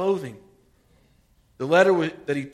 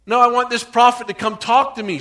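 loud, higher, indignant no; angry refusal; 7 semitones higher, 9 dB louder than usual. Retold or imitated. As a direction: Naaman refusing in a huff, loud. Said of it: "Naaman went away angry".

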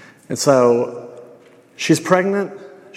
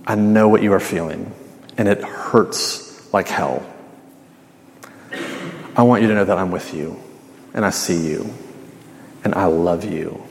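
tender reassurance; 6 semitones lower than usual. Acted. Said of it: "Jesus would look at you and say".

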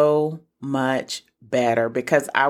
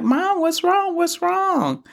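put-on high, anxious child voice, much higher; worried kids. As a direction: imitate your kids asking worried. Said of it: "my kids are gonna come up to me and like".

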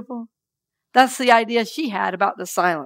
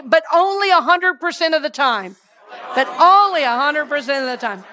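call and response; 5 semitones higher than usual. Shown as a demonstration. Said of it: "And now say this with me".

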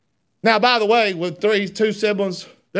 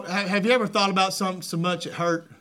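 usual speaking voice

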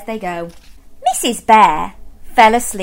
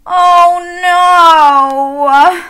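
higher, louder exasperated bear voice; fed-up bear; 8 semitones higher, 8 dB louder than usual. Acted. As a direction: groan it like a sleepless bear. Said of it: "said Mister Bear".

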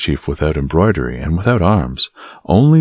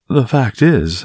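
tentative, higher-pitched opening; timid; 8 semitones higher, slower than usual. A narrator's reading. Young Gascon hazards an explanation to the guards, timidly. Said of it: "hazarded D'Artagnan timidly".

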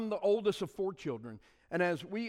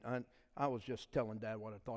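normal talking voice

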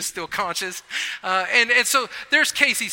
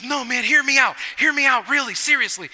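pleading; 5 semitones higher, 4 dB louder than usual. Retold or imitated. Casey insisting.

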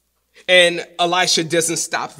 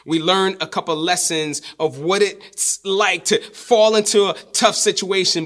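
ordinary talk, nothing marked

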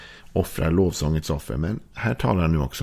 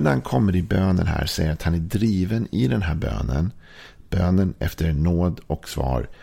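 ordinary delivery

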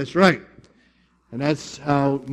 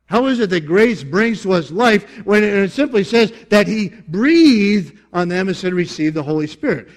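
louder, higher objection from a listener; challenging question; 5 semitones higher, 6 dB louder than usual. Acted. Congregant challenging the preacher on the verse, challenging. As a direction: press the question as a puzzled doubter. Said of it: "Now you say, "Wait a minute".

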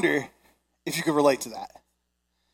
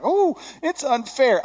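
high, drawn-out mock kid whine; whining; 11 semitones higher, 3 dB louder, slower than usual. Dad imitating his kids wanting more snack, whining. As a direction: whine it slowly like a complaining child. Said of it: "and they're whining".

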